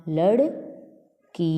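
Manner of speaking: लड़कियाँ stretched very slowly; slow classroom drawl on लड़कियाँ; drawn-out word. Shown as a demonstration. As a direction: pull लड़कियाँ out syllable by syllable.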